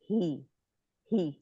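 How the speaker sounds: usual speaking voice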